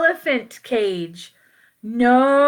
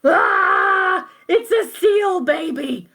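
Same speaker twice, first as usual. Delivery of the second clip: yelling; 7 semitones higher, slower than usual. Acted. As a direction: shout it, slowing on "wrong baby". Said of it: "And he yelled".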